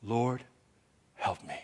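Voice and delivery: hushed desperate prayer; quiet plea. Retold or imitated. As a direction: murmur a quick prayer under your breath. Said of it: "I pray a, a simple prayer".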